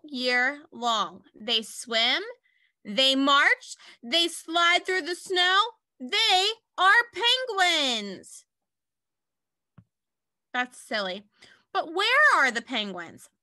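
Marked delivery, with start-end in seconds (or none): 6.0-8.4 slow, higher grand reveal to the class; dramatic reveal. Acted. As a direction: unveil the answer slowly like a surprise. Teacher reveals the book's answer.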